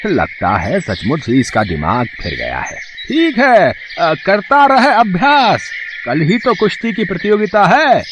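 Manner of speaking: put-on a jeering village chief who thinks the boy has gone mad; high-pitched mocking headman.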